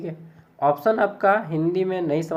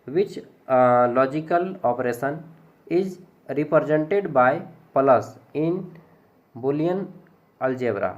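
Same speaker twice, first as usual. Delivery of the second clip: reading the English question very slowly, word by word; teacher's slow read-aloud of an English exam question; much slower than usual. Shown as a demonstration. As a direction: spell out each English word for the class. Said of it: "ये English को पढ़िए".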